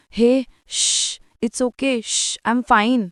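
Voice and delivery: slow, gentle hushing; soothing. A narrator's reading. Arnav comforting crying Ishwari, soothing. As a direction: hush and comfort, taking your time. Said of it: "Arnav तिची समजूत काढत बोलतो".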